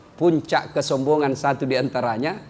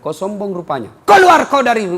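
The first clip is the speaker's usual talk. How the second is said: rising to a loud, angry command; stern expulsion; 4 semitones higher, 6 dB louder than usual. Acted. God banishing proud Iblis from heaven, stern and loud. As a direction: cast him out with force.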